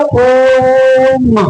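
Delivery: ओम held long and slow, a chanted pluta vowel; drawn-out om. Shown as a demonstration. Teacher to students showing a pluta vowel, long drawn ओम. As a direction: stretch ओम out to triple length. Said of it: "जिसे हम लोग कहते हैं ओम".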